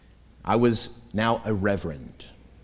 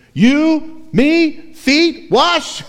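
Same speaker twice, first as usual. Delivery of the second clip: each word punched separately, much higher and louder; staccato disbelieving outburst; emphatic Greek order; 8 dB louder, much slower than usual. Shown as a demonstration. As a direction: hammer You, me, feet, wash one by one. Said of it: "The Greek is actually more emphatic".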